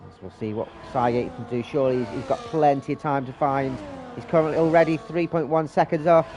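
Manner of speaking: brisk live play-by-play call; race commentary. Real, not acted. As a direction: call the qualifying laps as they happen.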